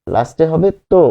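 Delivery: ordinary delivery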